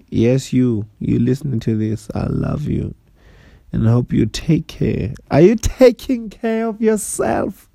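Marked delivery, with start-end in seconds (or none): 5.3-7.7 high, slow, lightly done Bill Burr; Bill Burr impression. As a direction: quote Bill Burr in his voice. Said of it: "That's what Bill Burr says".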